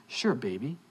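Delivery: low, soft, indulgent reply; smooth boyfriend. Retold or imitated. Boyfriend showing off to his date, smooth. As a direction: imitate a suave boyfriend, low and quiet.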